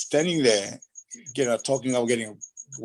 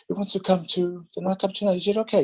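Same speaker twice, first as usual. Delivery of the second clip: Phyllis asking; 8 semitones higher than usual. Retold or imitated. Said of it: "old lady called Phyllis".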